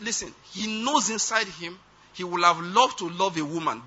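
ordinary delivery

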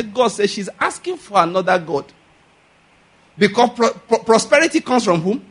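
ordinary talk, nothing marked

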